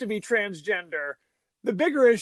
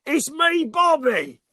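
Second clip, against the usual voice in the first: higher, loud manic cockney Michael Caine; crazy Michael Caine voice; 7 semitones higher, 4 dB louder, slower than usual. Retold or imitated. Bobby revealing himself on the tape in crazy Michael Caine cockney. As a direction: shout it like an unhinged Michael Caine. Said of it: "crazy Michael Caine voice".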